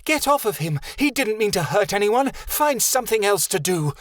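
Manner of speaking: high-pitched yell to defend him; screaming at the children. Acted. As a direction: shout them off him. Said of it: "screaming at the children".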